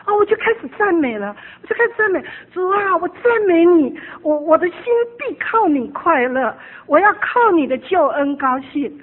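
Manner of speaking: high, loud praise with a break of crying; tearful praise. Retold or imitated. Retelling her morning praise to God, tearful and loud. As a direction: cry out praise to the Lord, choked up.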